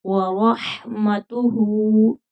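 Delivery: रहमतुहु slow, heavy ra, breathy stretched ha; व रहमतुहु drawn out; tajweed demo. Shown as a demonstration. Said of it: "हा को खींच कर पढ़ेंगे".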